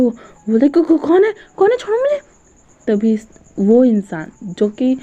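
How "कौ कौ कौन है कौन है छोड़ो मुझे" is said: high, stammering panic as he is grabbed; mock fright, voice jumping up; startled and scared. Grabbed from behind, Wang cries out in stammering fright. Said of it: "एकदम घबरा जाता है".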